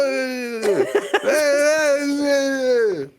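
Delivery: high, sustained comic wail; drawn-out mock wail. Podcaster ending the begging bit, drawn-out mock wail. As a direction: let out a long squealing cry.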